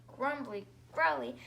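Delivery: ordinary delivery, nothing marked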